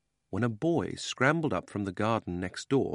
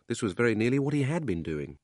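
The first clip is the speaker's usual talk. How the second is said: plain reading voice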